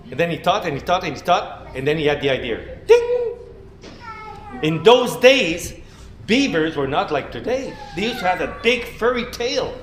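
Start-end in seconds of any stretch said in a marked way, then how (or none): none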